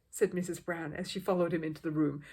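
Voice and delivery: plain reading voice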